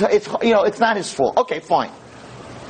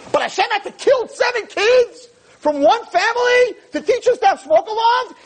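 much higher, mocking disbelief; incredulous; 13 semitones higher than usual. Real, not acted. Rabbi mocking the smoke alarm theory, incredulous high voice. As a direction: scoff at the absurd idea.

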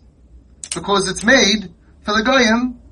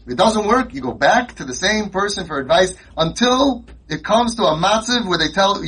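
ordinary talk

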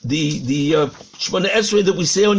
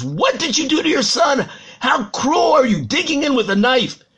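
high-pitched, indignant confrontation; acted appalled stranger's outburst; outraged accusation; 6 semitones higher than usual. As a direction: confront a parent in horror.

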